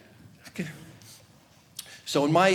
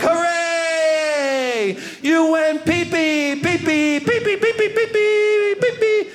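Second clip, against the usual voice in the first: much higher, loud sing-song cheering; pee-pee dance cheer; 9 semitones higher, 3 dB louder, much slower than usual. Retold or imitated. Praising the dog for peeing, giddy sing-song cheer. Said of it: "I'd be like".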